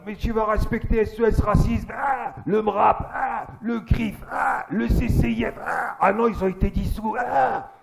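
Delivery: high, loud cries between each name; hammed-up agony, groaning between drawn-out phrases; mock dying protest. Impaled man still defending his group, mock death throes.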